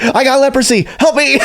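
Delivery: high laughing mock whine; mock whiny plea. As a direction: lampoon the desperate excuses. Mocking agent's absurd excuses.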